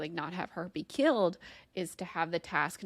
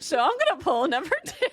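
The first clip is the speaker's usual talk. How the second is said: laughing; 10 semitones higher, 9 dB louder, faster than usual. Real, not acted. Laughing while drawing a card.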